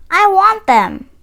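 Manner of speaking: ordinary delivery, nothing marked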